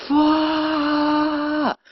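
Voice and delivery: high, loud, drawn-out re-enacted scream; yelled reaction. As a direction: let out one long high yell. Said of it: "I just went like".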